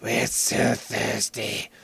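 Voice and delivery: slow, rasping zombie whisper; thirsty zombie liquid. Pretend. Liquid begging from the mouth, slow zombie whisper. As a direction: plead like a parched undead thing. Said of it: "the liquid in my mouth is going".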